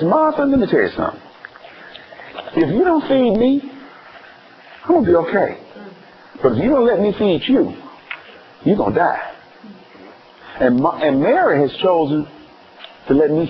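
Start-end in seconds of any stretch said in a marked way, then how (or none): 0.0-9.2 admonishing Jesus. Acted. Preacher voices Jesus admonishing Martha.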